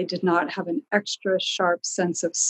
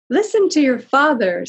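higher, louder sharp mother voice; shrill mother; 7 semitones higher, 6 dB louder than usual. A narrator's reading. Mother backing the father, ordering her son, shrill. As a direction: snap at the boy like a shrill mother. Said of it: "said the shrill voice".

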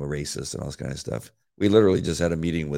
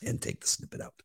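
hushed whisper to save her voice; 12 dB quieter than usual. Real, not acted. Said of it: "the night that I can't talk".

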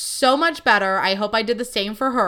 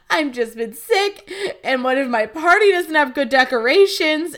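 mock spiralling worry, voice changed; retold breakdown; 4 semitones higher, 4 dB louder than usual. Daughter fretting to dad about decorations, acted breakdown. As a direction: act out a small breakdown over the party. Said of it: "at the end of this breakdown".

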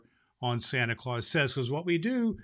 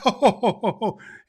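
hearty higher, louder Santa chuckle; jolly laugh; 7 semitones higher, 8 dB louder than usual. Acted. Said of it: "Our jolly old Santa laughed".

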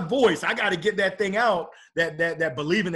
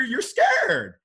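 quoting wife; 12 semitones higher, 6 dB louder than usual. Retold or imitated. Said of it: "she used a different word".

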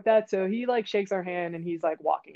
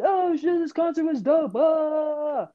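high, loud, drawn-out whoop; mock hyped-up yell with stretched vowels; imitating excited shouter; 8 semitones higher, 3 dB louder, slower than usual. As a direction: mimic someone hollering about a great show. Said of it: "you hear what I just said".

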